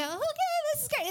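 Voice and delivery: high, squealed little acted cheer; hopeful delight. Speaker voices someone seeing a door open, high-pitched delight. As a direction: act out a high, pleased reaction. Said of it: "you're gonna go".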